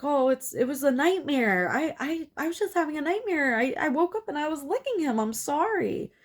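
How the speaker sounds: high, hurried, stammering excuse; flustered apology. Retold or imitated. Caught character apologizing to the group, high and flustered. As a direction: stumble through it in a high, nervous voice. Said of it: "And he's like".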